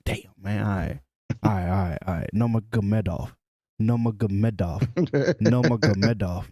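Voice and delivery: lightly imitated announcer, low and muttered; announcer practicing names. Announcer handed the list of names, reluctant practice. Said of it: "They hand him the list".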